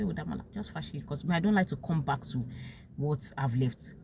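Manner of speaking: calmly turning a friend away; quiet firm refusal. Retold or imitated. Telling Damola on the phone, a soft firm no.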